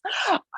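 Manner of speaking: sharp audible intake of breath; mock-shocked gasp. Confessing meditation to somebody else, mock-scandalised gasp.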